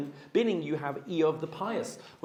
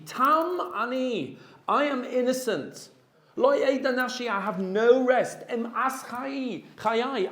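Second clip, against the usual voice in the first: high, drawn-out cry of protest; mock anguished cry; 6 semitones higher, slower than usual. Job at his wit's end protesting to God, anguished cry. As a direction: stretch the words out high, as if crying out at wit's end. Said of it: "he screams out in number twenty".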